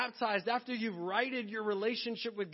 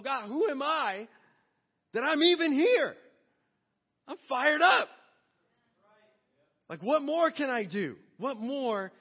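loud and higher, bursting with awe; fired-up praise; 6 semitones higher, 9 dB louder than usual. Acted. David voiced by the pastor, loud and fired up. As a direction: cry out like David overwhelmed with thanks.

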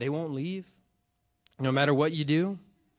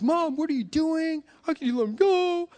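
high, loud mock wail; distraught child, retold; 12 semitones higher, 6 dB louder than usual. Upset child pleading with mom over runaway brother, mock distraught. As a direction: imitate an upset kid pleading, pitch leaping up. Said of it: "And I'm just crying".

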